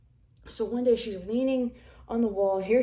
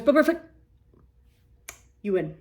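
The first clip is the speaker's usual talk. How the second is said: mock boss voice; 3 semitones higher, 6 dB louder, faster than usual. Retold boss conceding on the phone, mock voice. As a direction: toss off the boss's defeat in a put-on voice.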